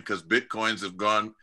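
ordinary talk, nothing marked

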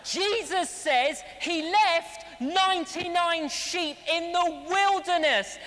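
usual speaking voice

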